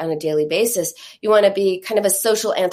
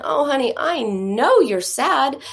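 higher, slow, drawn-out soothing; validating mom; 4 semitones higher than usual. Acted. Ideal mom to her sad little girl, validating. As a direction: comfort a small child sympathetically.

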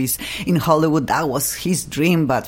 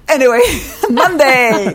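Monday said higher and louder through a laugh; radio host turning on a silly accent while laughing; mock accent with laugh; 9 semitones higher, 6 dB louder than usual. Shown as a demonstration. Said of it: "accent on".

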